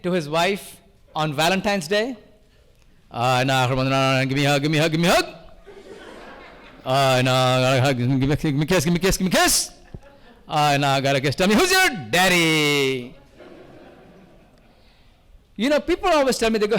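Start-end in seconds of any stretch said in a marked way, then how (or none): 3.1-13.2 low droning auctioneer chant, climbing at each payoff; romantic auctioneer. Pretend. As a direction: woo your wife in auction patter. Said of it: "Can you imagine an auctioneer trying to be romantic".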